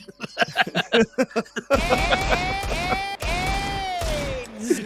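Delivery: high screaming laughter; shrieking laugh. Real, not acted. Huge laugh.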